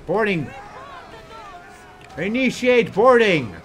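high, loud order with a falling end; captain calling to board; rising command. Acted. Repeating the order louder.

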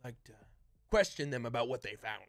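lightly voiced gruff band leader giving orders; Voltar. Game master voices Voltar.

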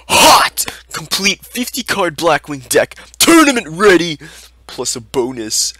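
punchy shouted listing headline; mock sales hype. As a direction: read the title like an overexcited seller, key words blasted. Mockingly reads out hype listing title.

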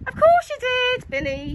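shouted. A narrator's reading. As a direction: call out indulgently to a child. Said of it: "shouted his mum".

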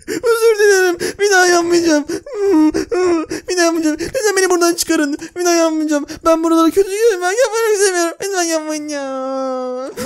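high, hammed-up crying; mock sobbing plea. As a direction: whimper and beg for mercy. Prisoner begs, mock crying. (yüksek perdeden sahte ağlayarak yalvarış)

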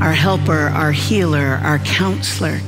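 normal talking voice

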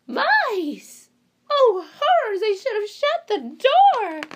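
very high, loud shriek of alarm; hammed-up horror at the mice; mock fright. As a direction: librarian panicking over mice. Librarian sees mice.

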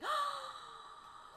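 a high, startled gasp; sharp gasp. Real, not acted. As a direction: suck in air in sudden surprise. Spots a rare Pokemon.

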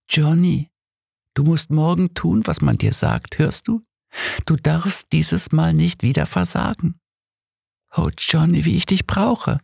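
high, longing woman's voice; ghostly lover. Acted. Dead Mary to Johnny, high and yearning. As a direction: plead sweetly as a dead lover.

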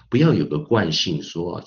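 ordinary talk

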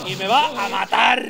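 loud, slowed, stretched warning; alarmed. Real, not acted. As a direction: drag it out in alarm. Enemy about to grab him.

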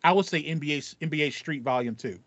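ordinary delivery

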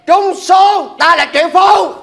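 loud, high triumphant cry; gleeful shout. Acted. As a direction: shout the win to the world. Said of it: "Dịch ra tiếng Việt".

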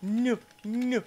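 high, put-on sneering boss voice; hammed-up cartoon boss turning Smithers down; mock villain boss. Boss refusing his assistant Smithers, high and sneering.

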